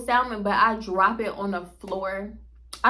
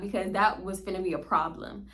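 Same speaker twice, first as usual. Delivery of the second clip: normal talking voice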